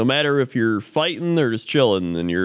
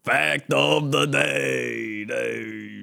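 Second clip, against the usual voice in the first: slow drawn-out title, echoing the last word softly; announcer with echo; 3 dB quieter, much slower than usual. Pretend. Announcing the fact segment with a mock echo. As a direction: boom out Fact of the day, then echo Day.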